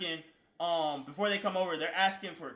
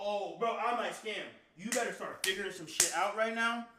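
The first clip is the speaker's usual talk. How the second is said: normal talking voice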